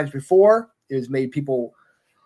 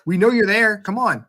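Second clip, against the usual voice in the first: yelling at the TV; 7 semitones higher, 5 dB louder than usual. Retold or imitated. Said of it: "yelling at the T V".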